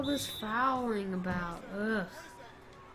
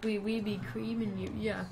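ordinary delivery

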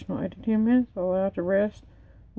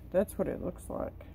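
usual speaking voice

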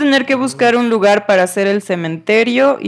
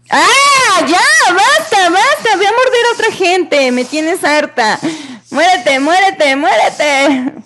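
furious screaming; 10 semitones higher than usual. Real, not acted. Gamer fed up with a biting snake, furious screaming. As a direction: scream at it to stop and die. Snake bites, she snaps.

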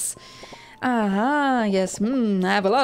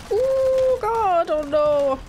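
real panic; 9 semitones higher than usual. Real, not acted. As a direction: beg in rising panic as health runs out. Boss fight, almost no health.

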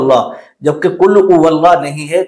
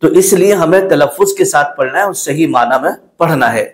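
ordinary delivery

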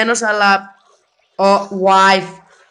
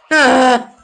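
normal talking voice

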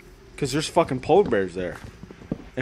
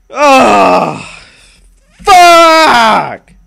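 high loud cry of annoyance; frustrated shout; 8 semitones higher, 12 dB louder than usual. Real, not acted. Streamer annoyed his house is unfinished, shouting. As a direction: yell it out in frustration.

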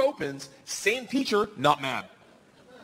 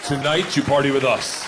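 slow, loud Russian cop; hammed-up cop voice, slow and emphatic; 6 dB louder than usual. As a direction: announce it slowly like an order. Russian cop's slow command.

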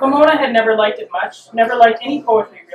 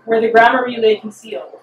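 ordinary delivery

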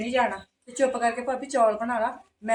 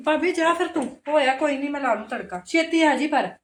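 higher, louder call after her; 4 semitones higher, 6 dB louder than usual. Acted. Girl calls after her sister-in-law leaving, loud raised call. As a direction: call it out as she leaves.

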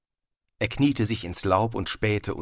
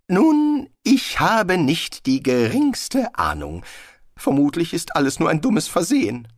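higher-pitched, agitated wizard voice; genervter Zauberer Rincewind; 8 semitones higher, 3 dB louder than usual. A narrator's reading. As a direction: lecture at length, high and exasperated. (aufgeregt dozierender Zauberer, hohe Stimme)

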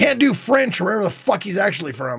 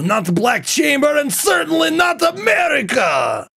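put-on Russian accent; 2 semitones higher than usual. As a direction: taunt like a hammy Russian game villain. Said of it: "I can do a Russian accent".

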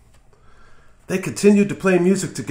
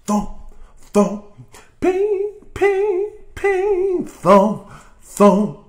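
guitar sound imitation; 5 dB louder, much slower than usual. Storyteller imitates Walter's guitar, thump and ping sounds. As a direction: voice the guitar strings. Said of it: "his fingers hammered down on the guitar strings".

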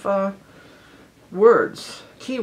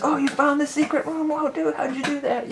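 much higher, amazed gamer praise; excited; 10 semitones higher than usual. Retold or imitated. Imitating awe at a brother finding the secret room, high amazed. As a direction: marvel at a friend's find. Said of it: "We were excited".

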